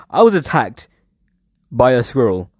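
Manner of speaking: usual speaking voice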